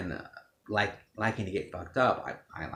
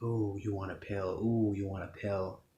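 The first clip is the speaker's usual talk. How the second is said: taunting craving voice. Retold or imitated. Craving taunting in his head.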